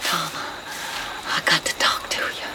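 soft secret whisper. Acted. Mother meets her hiding son at night, whisper. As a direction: whisper into the dark.

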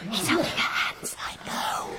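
urgent unvoiced whisper; whispered aside. Real, not acted. Onlookers remark on the rehearsal, whispering. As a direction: whisper it to your fellow watcher.

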